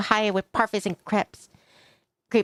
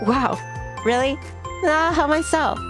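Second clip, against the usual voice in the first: higher-pitched, eager acceptance; eager delight; 6 semitones higher than usual. Acted. Guest offered seconds by the host, eagerly delighted. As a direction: jump at the offer.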